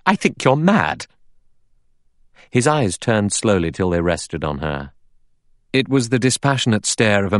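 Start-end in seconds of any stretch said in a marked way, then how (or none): 0.0-1.0 high-pitched angry outburst; angry cry. A narrator's reading. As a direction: fling the accusation out, voice jumping higher. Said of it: "Vera cried angrily".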